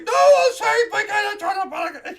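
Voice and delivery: high, loud muffled yelling; shouting through door. Acted. Quarreling lovers shouting behind the shop door. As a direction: yell it from another room.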